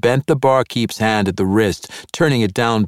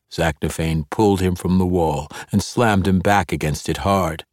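plain reading voice

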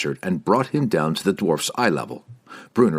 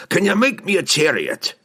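high, forceful gruff demand; demanding dwarf; 11 semitones higher, 3 dB louder than usual. A narrator's reading. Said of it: "the dwarf demanded".

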